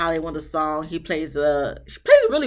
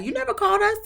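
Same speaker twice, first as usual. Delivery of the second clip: indignant disbelief; much faster than usual. Retold or imitated. Mom confronting dad.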